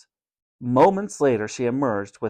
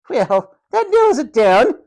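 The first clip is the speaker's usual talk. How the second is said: high, loud, withering sarcasm; mock him with haughty sarcasm; sarcastic snarl; 12 semitones higher, 6 dB louder than usual. A narrator's reading. Matron scorning boy's vague answer, sarcastic snarl. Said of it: "she snarled sarcastically".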